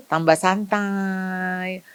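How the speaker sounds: very slow, drawn out "Tambah santai"; slowed-down relaxed. Shown as a demonstration. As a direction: stretch out "Tambah santai" lazily.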